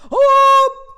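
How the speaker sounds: sudden very high, loud yelp; startled oh. Retold or imitated. Waiting woman startled when her number is called. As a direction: jump at the sound.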